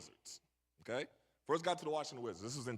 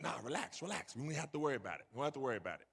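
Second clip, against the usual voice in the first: calming; 4 semitones lower, 6 dB quieter, much faster than usual. Retold or imitated. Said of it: "he's like".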